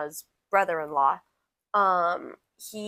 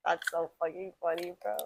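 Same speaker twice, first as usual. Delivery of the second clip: ordinary delivery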